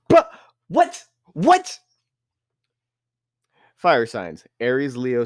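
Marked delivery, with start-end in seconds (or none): 0.1-1.8 squeaky high disbelief; high shocked but what. Real, not acted. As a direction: yelp it out, stunned. Shocked by sign list.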